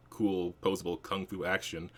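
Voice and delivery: ordinary talk, nothing marked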